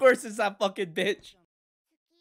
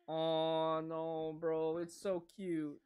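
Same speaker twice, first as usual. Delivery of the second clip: ordinary talk, nothing marked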